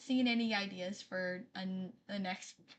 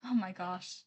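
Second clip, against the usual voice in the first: normal talking voice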